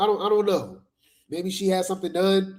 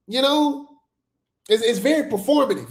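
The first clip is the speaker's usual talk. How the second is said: ordinary talk, nothing marked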